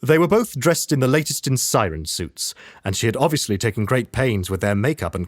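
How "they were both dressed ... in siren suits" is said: plain reading voice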